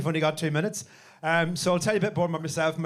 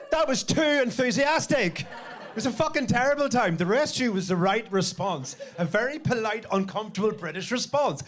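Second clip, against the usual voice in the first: usual speaking voice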